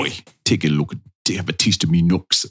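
put-on a half-hearted stab at a British accent, barely shifted; clumsy British accent. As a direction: toss off a weak Cockney-ish British accent, just lightly put on. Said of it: "the impression is coming together".

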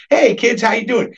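put-on high, sing-out greeting to the kids; imitated friendly grandparent. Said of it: "most grandparents are like".

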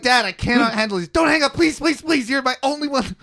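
high, frantic begging; desperate pleading. Retold or imitated. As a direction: beg him not to hang up. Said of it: "I'm like".

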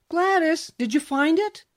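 high, loud mother's voice calling up; mother calling her daughter from closer by. A narrator's reading. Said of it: "the voice asked, this time sounding closer".

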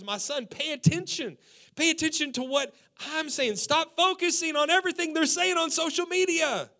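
a father pressing his son to listen; urgent fatherly plea. Retold or imitated.

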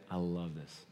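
very soft, low and earnest; heartfelt sincerity. Real, not acted. Preacher confiding love of the passage to congregation, heartfelt. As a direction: let it sink to a quiet confession.

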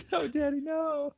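put-on high, slow whiny child voice; mocking pleading kid. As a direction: echo it back as a teasing whine. Mocks friend's pleading kid line.

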